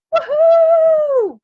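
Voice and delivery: high, loud joyful whoop; cheering whoop. Real, not acted. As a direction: throw it up like a cheerleader. Cheering after the mantra.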